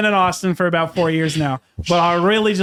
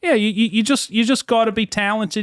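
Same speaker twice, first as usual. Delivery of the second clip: put-on Aussie accent, held steady; Australian accent. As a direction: fake Australian accent in character. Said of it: "continued the Australian accent".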